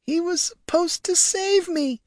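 high-pitched tearful lament; quiet sobbing. A narrator's reading. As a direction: sob it out high, like a forsaken princess. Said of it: "Marja sobbed quietly".

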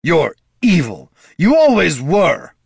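loud, high accusation; heated, emphatic denouncement; angry condemnation. A narrator's reading. Son angrily condemns his father.

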